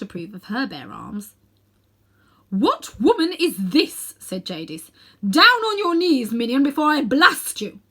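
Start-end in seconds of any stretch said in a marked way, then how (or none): 2.5-4.1 loud, slow, imperious queen's voice; haughty witch. A narrator's reading. Witch Jadis sneering at Aunt Letty. 5.3-7.8 threatening command. A narrator's reading. Witch threatening to blast Aunt Letty.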